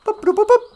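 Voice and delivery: high chirpy robotic beeping; computer beeps. Pretend. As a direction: beep like an old computer.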